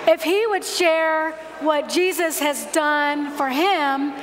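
ordinary talk

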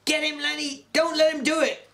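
high urgent yell. A narrator's reading. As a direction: yell encouragement across the room. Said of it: "George was on his feet yelling".

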